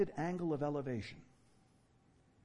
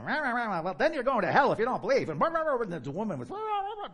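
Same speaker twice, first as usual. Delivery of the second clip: high, loud rah rah chatter; mocking argument babble; 7 semitones higher, 6 dB louder than usual. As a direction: imitate a heated argument as nonsense. Arguing girl mimicked as noise.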